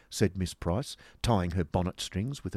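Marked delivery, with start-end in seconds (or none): none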